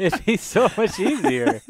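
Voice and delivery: laughing. Real, not acted.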